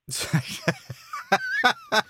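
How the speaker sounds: loud nose blow; honking into a tissue. Real, not acted. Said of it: "that was me blowing my nose".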